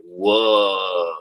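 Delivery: long, drawn-out deep whoa; imitated amazed, deep-breathed whoa. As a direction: let a low whoa stretch out in awe. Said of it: "My voice actually sounds a bit deeper too".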